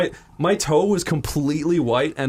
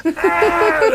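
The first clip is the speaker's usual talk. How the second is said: loud, high mock scream; retold pain yelp; 13 semitones higher, 5 dB louder than usual. Patient with syringe in his toe, mock scream. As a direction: imitate screaming from a needle jab.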